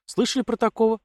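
casual high-pitched innocent question. A narrator's reading. Girl asking papa about the count, feigning innocence. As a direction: ask lightly, as if the answer hardly matters. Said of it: "Невинно поинтересовалась девчонка".

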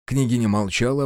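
ordinary delivery, nothing marked

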